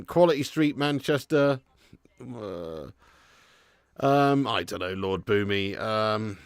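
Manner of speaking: usual speaking voice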